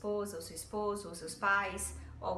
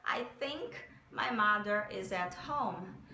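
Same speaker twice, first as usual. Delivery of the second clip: slow, careful English example; slow example sentence; slower than usual. Shown as a demonstration. Teacher gives a slow sample sentence with think. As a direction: read it slowly so students catch think. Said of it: "A palavrinha think".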